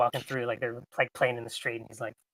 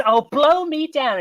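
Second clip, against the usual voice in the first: put-on high, eager young cabin boy; eager cracking teen voice; 11 semitones higher, 8 dB louder than usual. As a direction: squeak it out like a nervous teen. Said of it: "he says".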